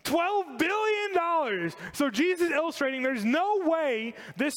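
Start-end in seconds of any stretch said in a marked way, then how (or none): 0.0-1.7 much higher, slow, drawn-out exclamation; stunned emphasis on the enormous debt; astonished exclaim. Real, not acted. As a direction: marvel at the huge sum slowly.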